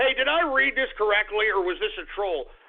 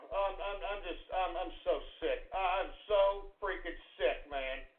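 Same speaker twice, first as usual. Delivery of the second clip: much softer, low, slowed and worn out; defeated mutter; 3 semitones lower, 11 dB quieter than usual. Acted. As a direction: sink into exhausted disgust. Host deflated by trolls.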